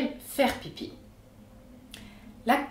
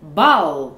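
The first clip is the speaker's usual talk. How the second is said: "Bal" as one loud, strong beat; stressed syllable; 5 semitones lower, 8 dB louder than usual. Shown as a demonstration. Teacher showing stress on a real word, "Bal" hit hard. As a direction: hammer the vowel of "Bal". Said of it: "voici comment ça sonne avec de vrais mots".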